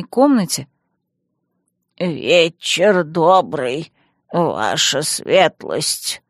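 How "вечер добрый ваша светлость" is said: put-on slow, low creaky old man voice; croaking old man. A narrator's reading. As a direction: croak it out like a frail grandpa. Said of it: "прокряхтел дед".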